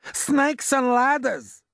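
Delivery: very high, loud, drawn-out cry; horrified yell. A narrator's reading. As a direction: yell it like an oath of terror. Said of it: "yelled the head of the fire department".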